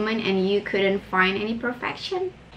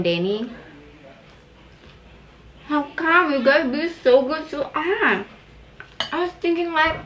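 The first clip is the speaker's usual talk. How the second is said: speaking between bites; talking while chewing. Real, not acted. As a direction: mouth full of salad. Seen 1: Eats salad throughout, lips pursed and chewing; new forkful at 8.7.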